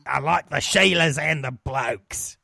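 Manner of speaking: put-on broad, slow Australian accent. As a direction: drawl it out like an Aussie bloke. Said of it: "say in an Australian kind of accent".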